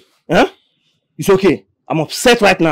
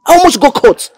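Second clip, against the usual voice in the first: alarmed outburst; 14 semitones higher, 10 dB louder than usual. Acted. Hacker tells of near arrest.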